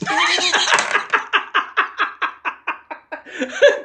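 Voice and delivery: high, loud cackling laugh; big laughing fit. Real, not acted. Player cracks up at the game, loud high laughter. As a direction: burst out laughing, long and loud.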